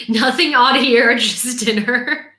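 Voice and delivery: helpless amused laughter while talking; laughing. Real, not acted. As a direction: protest through real giggles. Laughing disclaimer to Rick's wife.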